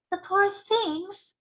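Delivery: put-on high, slow pitying girl's voice; a little girl feeling sorry; girl's cry. Sophie pities the dreams, high slow little girl. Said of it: "Sophie cried".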